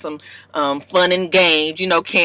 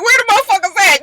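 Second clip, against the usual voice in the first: stunned disbelief; 5 dB louder, much faster than usual. Retold or imitated. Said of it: "You're like".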